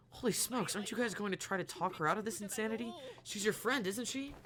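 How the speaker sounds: can't believe his ears, begging them; shocked protest. Acted.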